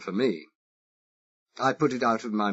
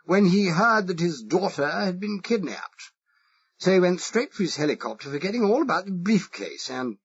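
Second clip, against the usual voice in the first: exaggerated posh English accent; 9 semitones higher, 3 dB louder than usual. Spy asking the Irish foreman, exaggerated posh English accent. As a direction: play the harmless toff with a marked English accent. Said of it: "I exaggerated it".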